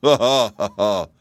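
put-on loud hearty chuckle from the old horse; chuckling. A narrator's reading. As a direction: laugh it out, amused and loud. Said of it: "chuckled the old cab horse".

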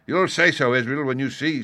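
high, loud assurance; confident pirate leader. A narrator's reading. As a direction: promise it boldly. Silver answers coxswain.

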